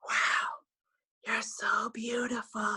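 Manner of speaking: slow, hushed, whispered imitation of a gushing man; mock awestruck. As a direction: breathe it out slowly, stopped dead in mock wonder. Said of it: "he just did this really ridiculous thing".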